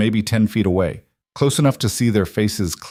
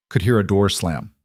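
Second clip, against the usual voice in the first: plain reading voice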